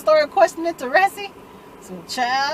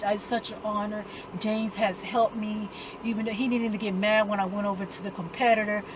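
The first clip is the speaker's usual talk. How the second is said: lightly voiced prep boy, softer; a hint of a polite, measured speechmaker; prep boy speech; 3 semitones lower, 6 dB quieter than usual. Imitating Andre's speech about the honor, mild prep boy manner. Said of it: "doing this little prep boy speech".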